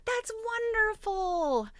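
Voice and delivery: gasp, then a high, bright "wonderful"; delighted gasp. Real, not acted. Reading a viewer's exciting news, delighted gasp. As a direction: catch your breath at the happy news, then gush.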